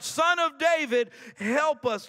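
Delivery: high, loud call for help; retold crying out. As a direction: cry it out like a preacher imitating a plea. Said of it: "and they cried out".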